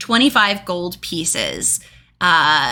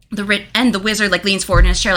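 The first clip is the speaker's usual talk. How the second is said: usual speaking voice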